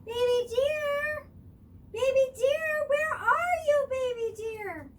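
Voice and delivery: very high, loud searching calls; calling out. A narrator's reading. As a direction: call across the snowy woods. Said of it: "the animals cried".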